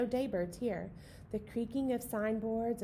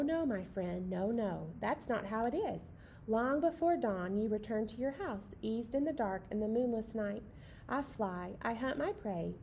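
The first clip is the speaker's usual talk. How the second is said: plain reading voice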